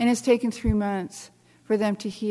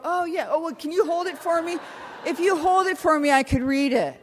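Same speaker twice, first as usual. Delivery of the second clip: mock squinting reader; 8 semitones higher than usual. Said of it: "They don't go".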